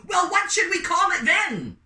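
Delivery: high, loud indignant yell; outraged shout. Acted. Outraged vendors demanding answer.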